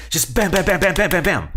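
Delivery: hyper energetic. Shown as a demonstration. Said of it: "because it's so high energy".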